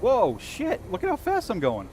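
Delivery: startled high exclamation; surprised. Real, not acted. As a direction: caught off guard by the speed. Sudden burst of speed in game.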